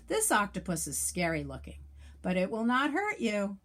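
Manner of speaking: usual speaking voice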